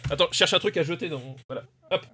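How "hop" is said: ordinary talk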